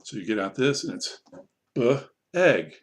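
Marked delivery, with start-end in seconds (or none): 1.8-2.7 short "B" sound, pause, then "egg"; blending demo. Shown as a demonstration. Teacher showing blending, "B" split from "egg". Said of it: "Here's the blending".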